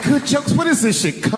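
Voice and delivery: very high-pitched indignant question; mock outrage, voice shooting up; imitated outraged wife. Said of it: "She's like".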